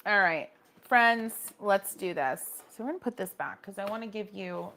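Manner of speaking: ordinary talk, nothing marked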